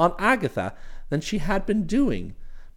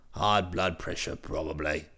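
much lower, hearty brash chap; cheery voice; 9 dB quieter than usual. Acted. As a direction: hail him with cheery familiarity. Bingley voiced again.

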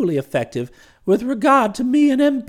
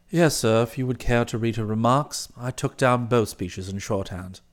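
low, soft, measured valet's report; composed valet Jeeves; 13 semitones lower, 6 dB quieter than usual. Acted. Valet reporting the meeting to his employer, composed and formal. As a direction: report every detail with unruffled formality.

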